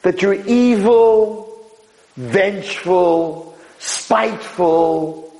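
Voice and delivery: high, very slow list of accusations; drawn-out emphasis. Real, not acted. Rabbi dismissing accusations against the cleaner, drawn-out emphatic words. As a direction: stretch each adjective out long. Preacher stretches each condemning word.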